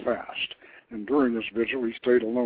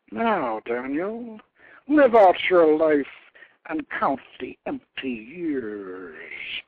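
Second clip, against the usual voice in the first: goddess's curse; 3 semitones higher, 9 dB louder, slower than usual. A narrator's reading.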